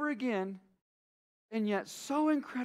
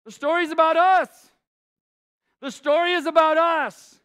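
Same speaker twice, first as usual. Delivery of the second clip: loud, higher, driving sermon climax; preaching at full force; 5 semitones higher, 8 dB louder than usual. Acted. Pastor pressing the point home to the congregation, fervent and loud. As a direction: build the altar call with fervor.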